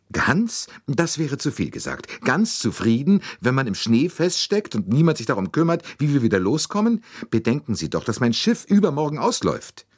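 high-pitched, exasperated lady; indignant lady passenger. A narrator's reading. Lady stuck in snow worrying about her ship, indignant. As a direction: protest loftily at being called happy.

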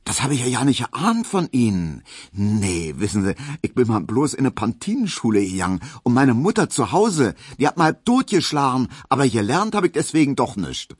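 put-on high, lively Berlin servant girl chattering; bubbly, high and quick as she confides; chatty Berlin maid. Hedwig telling Emil about her schooling, chatty and high.

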